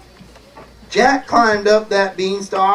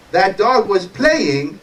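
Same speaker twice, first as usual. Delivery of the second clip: usual speaking voice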